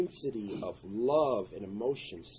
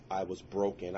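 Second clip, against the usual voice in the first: normal talking voice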